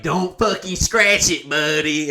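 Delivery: mock stern warning. Comic handing car keys to the valet, mock stern warning.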